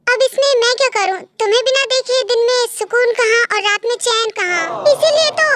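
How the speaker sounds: plain reading voice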